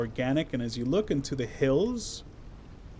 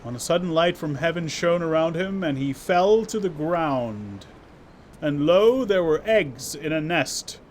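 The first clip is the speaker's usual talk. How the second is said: dramatic scripture reading; 3 semitones higher, 6 dB louder than usual. Pretend. Reads game text in mock scripture.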